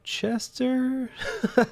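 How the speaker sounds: high embarrassed laugh. Real, not acted. Laughs at own mispronunciation.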